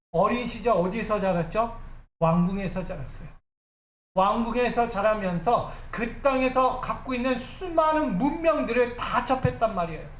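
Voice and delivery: usual speaking voice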